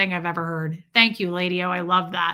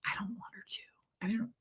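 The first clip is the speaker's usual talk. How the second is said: breathy whispered aside; unvoiced secret aside; 14 dB quieter, faster than usual. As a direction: whisper it so mom won't hear. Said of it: "I was like".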